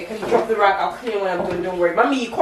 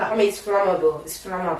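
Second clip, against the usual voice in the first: put-on English accent; 8 semitones lower than usual. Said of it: "carry your stupid accent away from here".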